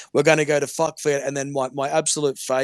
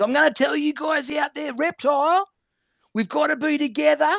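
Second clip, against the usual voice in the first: high, put-on imitation of a caller; Chrissy impression; 13 semitones higher, 4 dB louder than usual. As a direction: mimic her in a much higher voice. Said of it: "that was my best Chrissy impersonation".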